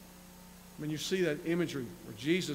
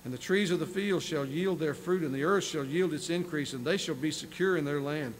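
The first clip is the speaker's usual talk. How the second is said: measured, slightly raised reading voice; scripture reading; 2 semitones higher, 4 dB louder than usual. Acted. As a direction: read the prophecy with weight. Preacher reads scripture aloud.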